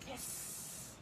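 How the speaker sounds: long unvoiced hiss through the teeth, like a snake; breathy sss with no voice, slithering. Shown as a demonstration. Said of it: "hiss like a snake".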